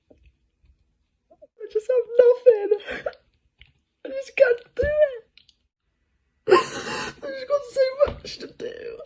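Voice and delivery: words forced out through real tears; crying hard. Real, not acted. Admitting to the camera she can't cope, sobbing. Breaking down while filming vlog.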